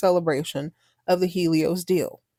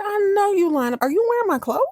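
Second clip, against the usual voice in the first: mock angry confrontation; 12 semitones higher than usual. Angry sister confronting rival.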